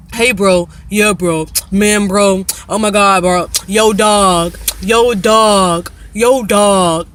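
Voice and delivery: loud mock street talk; loud parody of slangy phone chat; mocking bro slang. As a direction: ham up the yo dog greetings. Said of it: "We don't talk like that".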